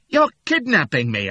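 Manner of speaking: loud, high accusing yell. Acted. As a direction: yell it in panic. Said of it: "I yelled".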